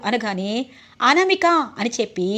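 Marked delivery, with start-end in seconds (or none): 1.0-1.5 sharp, higher and louder cry of a name; angry outburst. A narrator's reading. Husband raising his hand at his wife, angry outburst. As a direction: call out the name in anger, pitched up.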